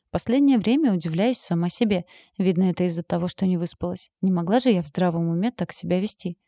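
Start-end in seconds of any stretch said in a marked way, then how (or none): none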